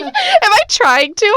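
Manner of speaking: high, slow self-doubting whine; mock insecure girl. Recalling her chubby curly-haired school days, mock insecure whine. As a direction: ham up your old insecure self, drawn out.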